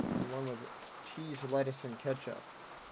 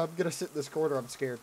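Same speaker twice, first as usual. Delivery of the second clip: ordinary delivery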